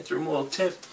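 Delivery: ordinary talk, nothing marked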